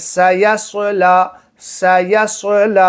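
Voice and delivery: slow سیصلی for repeating along, heavy saad; سیصلی drawn out, full "so" in the middle; correct saad. Shown as a demonstration. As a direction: model سیصلی, thin s then dark s. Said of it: "پڑھیے میرے ساتھ میں".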